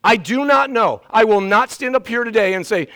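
normal talking voice

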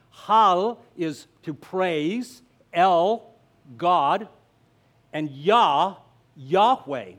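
"hal", "el", "Yah" each spoken slowly and apart with pauses; slow syllable breakdown. Shown as a demonstration. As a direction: lay out hal, el, Yah one piece at a time. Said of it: "when you break it down".

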